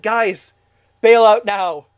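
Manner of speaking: urgent warning shout. Acted. Host yelling at the creators through the screen, urgent shout. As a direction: shout a frantic warning, bursting loud on the second phrase. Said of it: "reach through the screen and be like".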